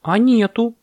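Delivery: high, surprised little monkey; genuinely puzzled, pitch jumping up; sincere surprise. A narrator's reading. Monkey finds her palms empty, surprised. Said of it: "искренне удивилась мартышка".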